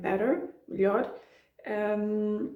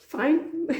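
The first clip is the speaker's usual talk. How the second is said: amused; 7 semitones higher, slower than usual. Retold or imitated. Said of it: "It's like".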